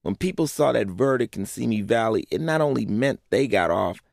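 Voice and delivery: normal talking voice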